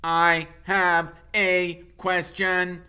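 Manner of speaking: booming robot mother. Acted. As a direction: boom it out over the crowd. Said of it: "came a booming voice".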